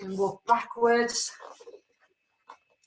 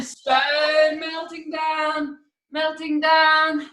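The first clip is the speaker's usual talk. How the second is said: slow, stretched-out words; long, sinking vowels; slow melting; much slower than usual. Shown as a demonstration. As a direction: draw it out as if melting down. Acting out a stone melting.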